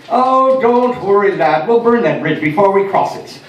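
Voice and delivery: Irish mum brogue, cheerfully wrong. Retold or imitated. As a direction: reassure the lad in a warm Irish brogue. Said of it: "with an Irish brogue".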